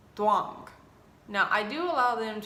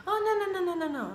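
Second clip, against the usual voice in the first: panicked refusal; 10 semitones higher, faster than usual. Retold or imitated. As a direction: whine it like a cornered kid. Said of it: "He's like".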